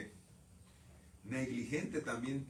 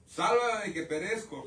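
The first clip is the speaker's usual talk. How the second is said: louder, slow plea to be saved; desperate cry for help; 6 semitones higher, 6 dB louder than usual. Retold or imitated. Preacher voicing Peter sinking in the water, loud slow plea.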